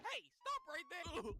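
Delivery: high slow hammed-up officer; cop voice. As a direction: drawl it like a cartoon cop. Cop stops him.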